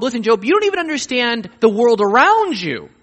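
God rebuking Job over the unseen realm, loud and high; booming God rebuke. Acted. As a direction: confront Job with it, building to a peak. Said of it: "he's showing Job".

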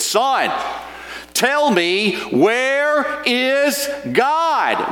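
very high, very slow thundering question; booming preacher. Retold or imitated. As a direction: roar down at the scared boy. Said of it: "big, burly pastor stood up".

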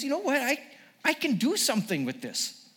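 put-on English accent, starting high; lilting mock-English voice; Jesus. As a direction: have an idea in an English accent. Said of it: "an English accent".

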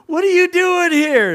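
very high, loud astonished cry; mock squeal of happy disbelief; imitated overjoyed brother. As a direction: act out a thrilled surprise reunion. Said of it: "And he gets all excited".

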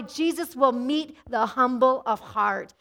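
ordinary talk, nothing marked

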